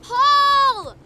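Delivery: loud, high call, drawn out; urgent shout to a runaway boy. Acted. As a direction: yell the boy's name across a distance. Calling boy away from bison.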